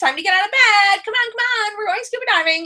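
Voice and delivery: chirpy wake-up call to a hungover crew; bossy wake-up call. Retold or imitated. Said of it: "the ship's captain for this vacation".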